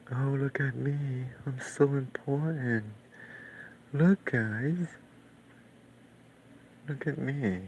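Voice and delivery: high, slow, preening show-off voice; mock self-important voice. Mocking the actor at the academy, in a high, preening voice. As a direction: lampoon a vain actor who wants all eyes on him. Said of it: "They're like".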